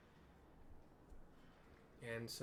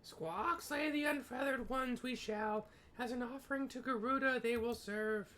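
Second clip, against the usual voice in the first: much higher, louder bird-creature voice; squawking birdman; 12 semitones higher, 6 dB louder than usual. Acted. Ixal birdman shouting to slay intruders, squawking high.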